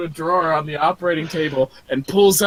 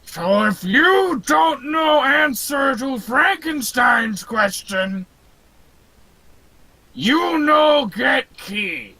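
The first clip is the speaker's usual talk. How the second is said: slow, high-pitched monster bellow; dim-witted creature laboring through broken English; Frankenstein's monster; 5 semitones higher, slower than usual. Acted. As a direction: stretch each word like a lumbering giant. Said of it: "giant behemoth of a corpse man".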